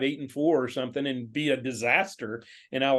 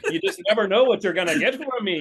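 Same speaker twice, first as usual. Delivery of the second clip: ordinary talk, nothing marked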